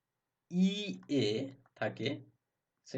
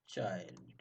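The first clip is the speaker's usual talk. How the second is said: quiet repeat; 6 semitones lower, 10 dB quieter, slower than usual. Shown as a demonstration.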